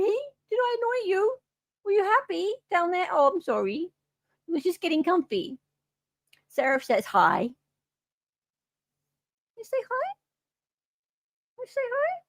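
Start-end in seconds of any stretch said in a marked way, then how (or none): 0.0-5.6 pet talk. Real, not acted. Cooing at the cat. 9.6-12.2 high, soft coaxing question; much higher, softer pet voice. Real, not acted. Coaxing cat to say hi.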